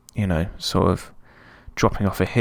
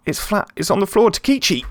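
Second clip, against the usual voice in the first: excited; 12 semitones higher, 4 dB louder than usual. Real, not acted. Gamer commentating his own attack, excited high call. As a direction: voice jumps high, rising thrill as the chance opens. Goal chance in football game.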